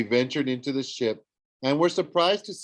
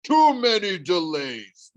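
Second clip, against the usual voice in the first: slow, louder monster bellow; 6 semitones higher, 5 dB louder, slower than usual. A narrator's reading. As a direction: roar it at the weaver. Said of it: "the monster bellowed".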